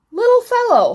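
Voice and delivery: high, loud call to someone small; calling out brightly. A narrator's reading. Miss Sparrow invites the elf for a ride, high loud call.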